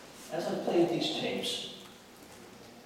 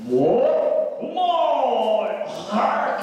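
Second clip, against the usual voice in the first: loud, higher cry of "What? My heart!"; imitating the tape voice; 6 semitones higher, 8 dB louder, much slower than usual. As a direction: shout it like the voice on the tape. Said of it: "what it said was".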